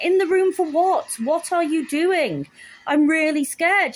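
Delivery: high-pitched, exasperated fright; retold frightened protest. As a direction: challenge the doctor in mock alarm. Acts out frightened protest to doctor.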